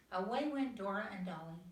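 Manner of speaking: plain reading voice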